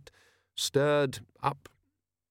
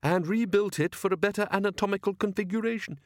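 lightly voiced bickering inventor and grumbler; story characters in dialogue; 7 semitones higher than usual. A narrator's reading. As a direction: play a polite enthusiast and a grumbling crewman, a bit higher.

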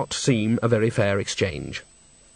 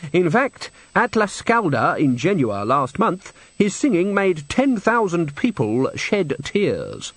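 slow, raised, grand proclamation; prim boasting manager; 6 semitones higher, slower than usual. Acted. Manager boasts of the Genua performance, slow and grand. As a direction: dwell proudly on each phrase.

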